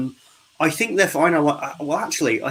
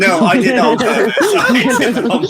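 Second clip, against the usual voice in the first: loud, high protest through laughter; laughing; 11 semitones higher, 6 dB louder than usual. Real, not acted. Host teased about cutting to a guest, laughing. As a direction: break into laughter while protesting.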